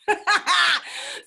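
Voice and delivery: quick bright burst of laughter; high laugh. Real, not acted. Instructor laughing at herself mid-lesson. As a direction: let a real laugh escape. Laughing at her own mucus joke.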